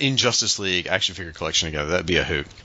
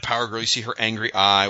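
normal talking voice

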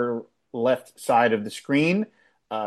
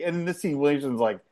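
ordinary delivery

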